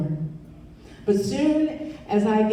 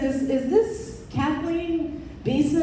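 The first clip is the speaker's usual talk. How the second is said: lightly voiced caller; 7 semitones higher, slower than usual. Caller's voice on phone.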